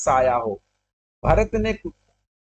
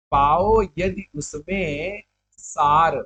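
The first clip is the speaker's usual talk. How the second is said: slow, sustained line of poetry; verse recitation; much slower than usual. Acted. Teacher reads the poet's line aloud, slow recitation. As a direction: stretch each word as verse.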